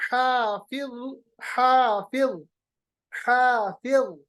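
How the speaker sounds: حافظ said several times, ending in a thick ظ; heavy dha drill. Shown as a demonstration. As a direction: repeat حافظ with a full ظ. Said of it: "ظا کو یاد رکھیے گا". (حافظ کی مشق، موٹا ظ)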